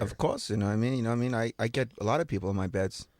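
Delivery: put-on New York accent. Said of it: "you sound like you're from New York".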